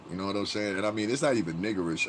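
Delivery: usual speaking voice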